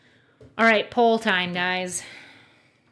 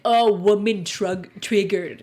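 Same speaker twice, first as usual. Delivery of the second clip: slow, dopey mock voice; mocking dumb guy voice; 5 dB louder, slower than usual. To a woman guest, slow mocking voice. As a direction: drag out a mocking put-down. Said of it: "I'm just gonna degrade her by being like".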